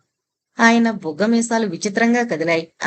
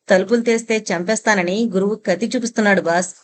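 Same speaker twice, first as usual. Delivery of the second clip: plain reading voice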